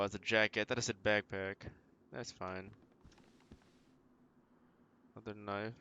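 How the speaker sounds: normal talking voice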